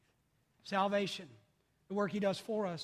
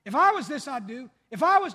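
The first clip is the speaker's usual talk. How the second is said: mock church critic; 3 semitones higher, 9 dB louder than usual. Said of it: "it does the church".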